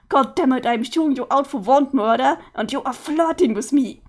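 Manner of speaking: ordinary talk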